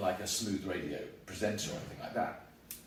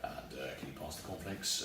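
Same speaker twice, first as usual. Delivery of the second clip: mock pilot over cornflakes; pilot voice at breakfast; 9 semitones lower, 11 dB quieter than usual. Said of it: "over breakfast they go".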